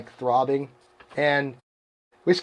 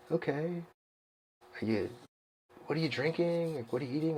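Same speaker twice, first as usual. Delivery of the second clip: much softer, hesitant pauses; gently probe, imitating a puzzled buddy; retold cautious questioning; 15 dB quieter than usual. Pacer asking runner about his diet, cautious and quiet.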